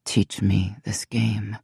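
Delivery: slow, low and soft, almost purring; a king coaxing his mate, slow seductive murmur. Acted. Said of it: "his voice low and seductive".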